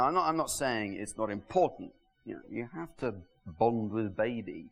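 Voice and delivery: ordinary talk, nothing marked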